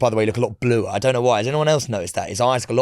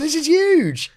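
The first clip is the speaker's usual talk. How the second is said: much higher, louder mock outrage; mock outraged; 4 dB louder, slower than usual. Hyping the award to his co-host, in mock outrage. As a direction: cry it out in pretend disbelief.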